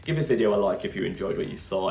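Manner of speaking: ordinary talk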